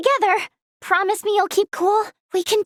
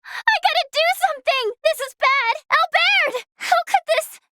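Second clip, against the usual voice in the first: high, urgent cries to fallen allies; pleading high-pitched panic; panicked distress; 7 semitones higher than usual. Acted. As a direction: cry out their names in desperate alarm. Allies falling in battle.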